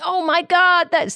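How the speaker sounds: put-on high, gushing American accent; hammed-up American tourist accent, pitched high; bad American accent. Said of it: "Sorry for the bad American accent".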